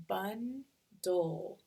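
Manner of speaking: very slow bundle, bun... dle; "bundle" pulled into two beats; split into syllables. Shown as a demonstration. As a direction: sound out bundle with a gap before dle. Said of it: "I hear that final syllable".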